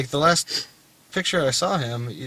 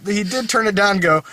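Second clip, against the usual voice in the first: ordinary talk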